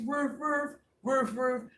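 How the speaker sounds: high doubled woofs; imitated dog barks. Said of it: "Even the dogs is out there".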